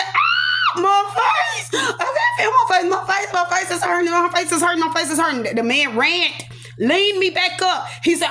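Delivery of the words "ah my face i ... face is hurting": shrieked high, then tumbling repeated wails; acted scream of pain, easing into high wailing; retold scream. Patient laid on x-ray table, retold screaming in pain. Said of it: "I screamed".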